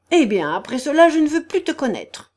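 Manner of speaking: loud outburst rising high; indignant. A narrator's reading. The general's wife disowning the prince, indignant. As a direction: cut him off in anger.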